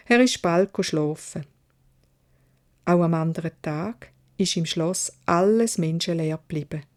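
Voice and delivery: usual speaking voice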